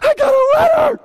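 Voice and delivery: very high, loud ecstatic shout; overjoyed. Retold or imitated. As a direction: shriek like it's a miracle. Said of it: "you're like".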